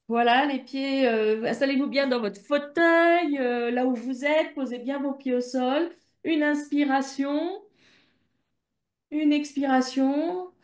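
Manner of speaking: calm, slowing guided-breathing voice; guided relaxation. Acted. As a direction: settle the listeners into their chairs, unhurried. Host guides listeners to relax.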